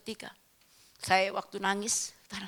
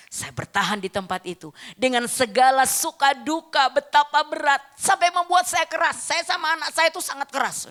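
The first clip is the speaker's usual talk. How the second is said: loud, raised-pitch testimony from the pulpit; forceful preaching; 6 semitones higher, 8 dB louder than usual. Real, not acted. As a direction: drive the hardship home to the congregation. (berkhotbah lantang penuh tekanan)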